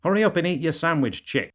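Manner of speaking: high, loud, fast scolding wife voice; impatient wife. Acted. Wife to husband staring at his sandwich, impatient. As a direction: hurry a spouse along, high and quick.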